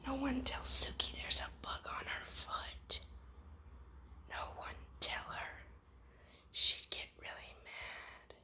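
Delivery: hushed unvoiced whisper. Real, not acted. Owner whispering beside sleeping dog Suki. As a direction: keep it to a breath so she sleeps. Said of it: "We gotta step ever so quietly".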